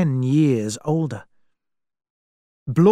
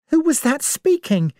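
high, startled cry; young woman crying out in fright; frightened cry; 13 semitones higher than usual. Acted. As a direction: blurt it out in alarm. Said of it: "cried Vera".

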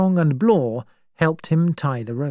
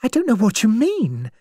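higher, alarmed protest; crying out; 7 semitones higher, faster than usual. Acted. Vera protests to the old general, alarmed. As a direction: push back in alarm, voice rising. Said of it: "cried Vera".

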